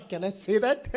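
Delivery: real chuckle breaking into the question; amused laughing. Real, not acted. Host teasing the guest about women fans, laughing. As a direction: ask it teasingly through a laugh.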